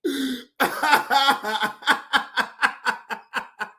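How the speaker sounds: long, high, trailing cackle; uncontrollable laugh. Real, not acted. Streamer unable to stop at the joke, uncontrollable laugh. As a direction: crack up helplessly.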